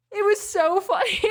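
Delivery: bursting into high laughter; laughing. Real, not acted. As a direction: laugh through the words. Laughing at party memory.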